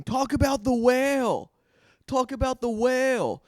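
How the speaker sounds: high, loud, slow yelled demand; high, loud drunk heckler yell; mock drunk heckler scream. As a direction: shout it like a drunk lady in the crowd. Heckler lady screaming request.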